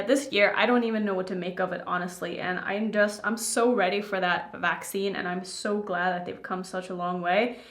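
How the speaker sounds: ordinary delivery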